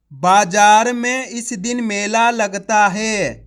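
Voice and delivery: slow, loud, every word clear; slow careful reading. Shown as a demonstration. Teacher to pupils, reading the sentence slowly and clearly. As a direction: read the whole sentence slowly for children. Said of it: "कैसे पढ़ेंगे अपन इसको?".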